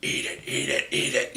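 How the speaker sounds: whispery mock-guttural urging; guttural woman. Date urging him on while he is pinned, guttural whisper. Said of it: "she almost sounds gutter".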